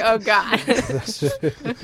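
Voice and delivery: retold reaction through laughter; laughing. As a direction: groan it out while laughing. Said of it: "I was laughing".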